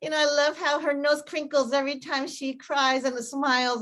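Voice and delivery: high, drawn-out gushing; mock lovestruck. Lover complimenting a partner, exaggerated adoring coo. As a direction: coo over a sweetheart, hammed up. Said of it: "when they're so in love and they're like".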